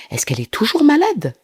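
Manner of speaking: high-pitched acted question; imitated questioning. Journalists questioning Belle about her illness, high-pitched mock question.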